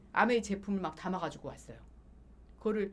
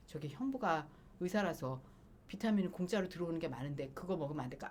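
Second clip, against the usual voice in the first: timid request; 4 semitones lower, 6 dB quieter than usual. Retold or imitated. Timidly asking to skip vitamins.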